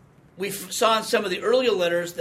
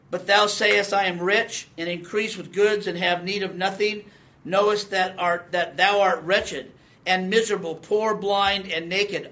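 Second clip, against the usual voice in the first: louder, solemn preaching read of the verse; scripture reading; 2 semitones higher, 5 dB louder than usual. Acted. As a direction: proclaim it from the pulpit. Reads Revelation passage.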